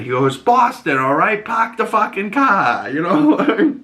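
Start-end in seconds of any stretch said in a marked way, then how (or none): 0.4-2.9 "Boston" with the r dropped, a hammed-up Boston bark; hammed-up Boston accent. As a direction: Mark Wahlberg's character proving he's from Boston with a mock Boston accent. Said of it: "I didn't hear your accent".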